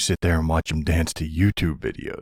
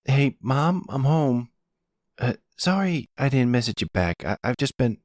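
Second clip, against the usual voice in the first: put-on higher, breezy young man's voice; young son coming home; 8 semitones higher than usual. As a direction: call in casually as the son walking through the door. Danny comes home.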